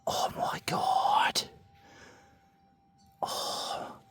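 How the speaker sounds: slow, drawn-out whisper in awe. Real, not acted. Whispering in awe at the nugget, camper nearby. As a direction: breathe the words out, no voice. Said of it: "I'm being really quiet".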